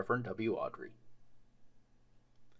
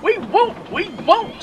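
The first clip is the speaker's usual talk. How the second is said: very high, loud defiant squeal; squealing we won't, rising; screaming trucks; 8 dB louder, slower than usual. Acted. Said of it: "screamed the trucks".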